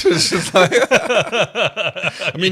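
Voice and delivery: laughing through the words. Real, not acted. Host laughing at the peeing scene, laughing. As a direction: crack up mid-sentence.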